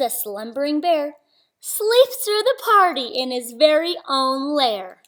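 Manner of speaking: ordinary delivery, nothing marked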